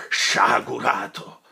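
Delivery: sonorous slow cry; slow thundering rebuke. Acted. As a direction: boom it out slowly. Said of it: "voce che aveva ripresa tutta l'antica pienezza e sonorità".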